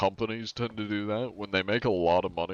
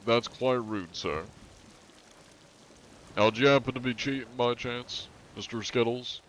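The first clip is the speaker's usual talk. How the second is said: mock polite questioning; 4 semitones higher than usual. Same interrogation repeated.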